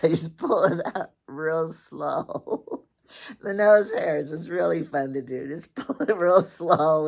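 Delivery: can't stop laughing at the prank; laughing while telling. Real, not acted.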